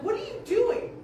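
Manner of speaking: high startled yelp; startled question. Retold or imitated. Uncle startled by the prank, high yelp. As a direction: sound caught off guard by the prank.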